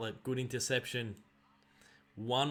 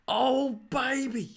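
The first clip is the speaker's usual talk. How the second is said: much higher, louder hammed-up caller; Dwayne Russell impression; 11 semitones higher, 7 dB louder, much slower than usual. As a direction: squeal it like the TV commentator. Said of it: "Dwayne Russell goes a bit of".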